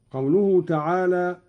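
normal talking voice

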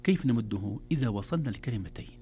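usual speaking voice

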